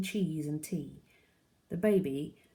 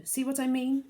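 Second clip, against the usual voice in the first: higher, sharp exasperated question; 10 semitones higher, 5 dB louder than usual. A narrator's reading. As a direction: make him look, fed up. Mum pointing out window.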